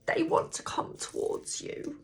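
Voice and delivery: unvoiced, barely a whisper; voice gone whispery. Real, not acted. Reader tells viewers they are wanted, whispering. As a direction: let the voice drop to a whisper. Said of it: "my voice has gone like that".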